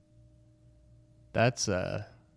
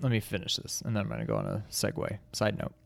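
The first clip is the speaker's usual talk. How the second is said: usual speaking voice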